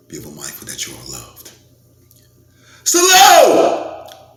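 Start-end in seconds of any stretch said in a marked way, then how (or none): 2.8-4.4 huge high shouted salute. Real, not acted. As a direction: blast it out to the crowd. Sign-off shout.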